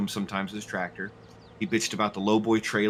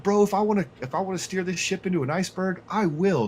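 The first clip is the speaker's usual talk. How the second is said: retold defiant rant; 8 semitones higher than usual. Streamer retelling his captain rant at the guy, raised defiant voice. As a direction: re-enact a defiant rant, pitched up.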